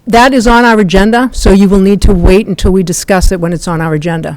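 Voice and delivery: loud and firm, stopping someone mid-speech; firm interruption. Real, not acted. Chair cuts off a speaker.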